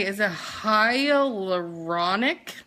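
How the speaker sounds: hyaluronic spelled out slowly, syllable by syllable; slowed down carefully. Shown as a demonstration. Host carefully sounding out a hard product word, slowly. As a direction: take hyaluronic very slow and careful. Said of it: "let me see if I can say".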